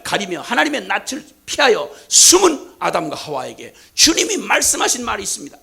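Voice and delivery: ordinary talk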